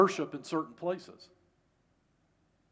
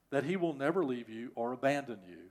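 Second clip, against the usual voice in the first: normal talking voice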